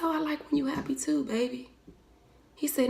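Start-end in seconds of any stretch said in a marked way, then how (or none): 0.0-1.7 softer, quickly and gently; tender reply. Retold or imitated. Mother answering her little son tenderly, soft and quick. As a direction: tell him like you're holding him.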